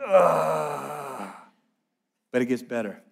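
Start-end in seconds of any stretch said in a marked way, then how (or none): 0.1-1.3 long, drawn-out groan of pain; mock pained "ugh"; imitated moan. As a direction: groan as if flat on your back after a fall. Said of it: "just moaning".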